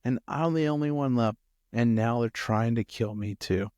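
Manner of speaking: subdued complaint, sinking at the end. Acted. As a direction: say it meekly, the boldness gone. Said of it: "I bet not as boldly this time".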